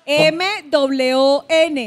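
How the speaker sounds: "M W O N" letter by letter at half speed; spelled out. Real, not acted. Host spelling the answer for contestants, slow letter by letter. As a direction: spell "M W O N" slowly and distinctly.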